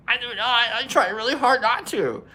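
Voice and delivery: high-pitched, whiny-style retold protest; mock indignant denial. Denying the accent to teasers, mock indignant and high-pitched. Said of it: "'cause they're like".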